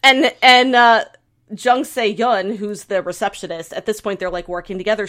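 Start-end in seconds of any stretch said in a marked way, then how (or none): none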